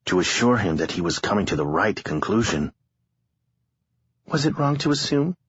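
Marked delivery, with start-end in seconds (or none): 4.3-5.4 lightly voiced young woman, higher; a hint of a tired young woman. A narrator's reading. Harlow voiced in narration.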